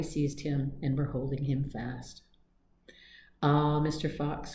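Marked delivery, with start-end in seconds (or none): none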